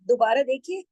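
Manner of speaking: ordinary talk, nothing marked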